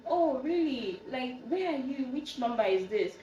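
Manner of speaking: lightly acted pleasant surprise; retold surprise. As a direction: act out puzzled phone questions. Her surprised reply on the phone.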